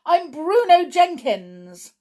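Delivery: high, loud defiant shout. A narrator's reading. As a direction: refuse at full volume. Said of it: "Bruno shouted".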